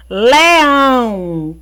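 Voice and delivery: LEÃO with a long, drawn-out ÃO; stressed syllable shown. Shown as a demonstration. Teacher quizzing pupils on the strongest syllable, Leão drawn out on ÃO. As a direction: stretch Leão so the final ÃO stands out. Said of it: "Qual é a mais forte?".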